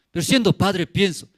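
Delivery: normal talking voice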